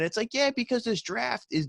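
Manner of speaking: sudden high sarcastic "yeah"; mock exasperation. Real, not acted. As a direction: pitch up in scornful disbelief. Ranting at the draft logic.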